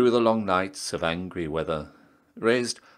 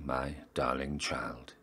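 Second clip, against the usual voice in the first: soft unvoiced whisper to the child; 5 semitones lower, 10 dB quieter than usual. Mother calling her child close, in a hushed whisper. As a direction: breathe it like a tender secret.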